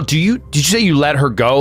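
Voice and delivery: normal talking voice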